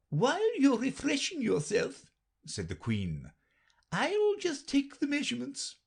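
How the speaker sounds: very high brisk queen; matter-of-fact and a little impatient. A narrator's reading. Queen answers Alice, very high and brisk.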